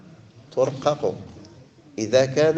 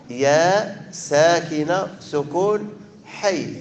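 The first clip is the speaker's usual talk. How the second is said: ordinary delivery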